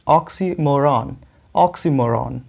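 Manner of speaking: ऑक्सीमोरन as ox-ee-MO-ran, slow and deliberate; correct pronunciation. Shown as a demonstration. Teacher models it for students, ऑक्सीमोरन as ox-ee-MO-ran. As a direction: stress MO in ऑक्सीमोरन, full open final "ran". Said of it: "इसको कैसे pronounce करेंगे".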